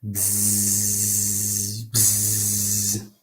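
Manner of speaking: buzzing. Acted. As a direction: drone like a fly. Fly buzzing sound.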